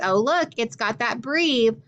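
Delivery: normal talking voice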